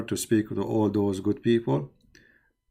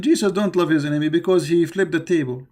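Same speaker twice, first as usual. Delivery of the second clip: sneering higher voice, quoting an opponent; mocking quote; 6 semitones higher, 3 dB louder than usual. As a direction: mimic him with scorn.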